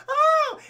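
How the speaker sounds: high mock yelp. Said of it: "She's like".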